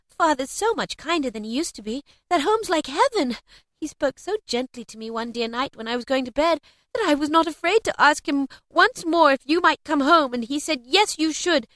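put-on high, eager child's voice; bubbling, high-pitched child's chatter; gleeful little girl. A narrator's reading. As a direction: pour out happy news as a small sister. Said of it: "brimful of glee".

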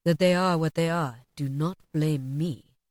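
a hushed otherworldly spirit; unearthly voice. A narrator's reading. Ghost answers Scrooge.

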